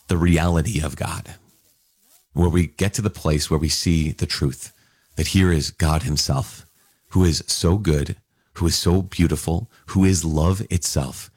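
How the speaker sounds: normal talking voice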